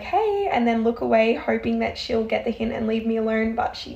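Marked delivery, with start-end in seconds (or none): 0.0-0.5 a high, awkward little hey. Retold or imitated. To a stranger who won't leave, an awkward high hey. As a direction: brush her off with a nervous greeting. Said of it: "I sort of awkwardly turn to her like".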